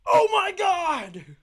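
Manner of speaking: very high, loud, drawn-out scream; the religious friend screaming at the gargoyle. Retold or imitated. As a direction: shriek it in terror. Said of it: "He screamed".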